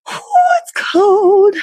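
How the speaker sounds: ordinary talk, nothing marked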